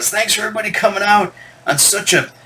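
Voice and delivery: a hint of stage sermon; preacher voice. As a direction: frontman thanking the crowd like a preacher. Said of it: "he talked like a preacher almost".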